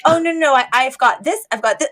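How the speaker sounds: lightly voiced childish boasting; like a little child. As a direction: list them out like a little kid. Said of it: "lists them out like a little child".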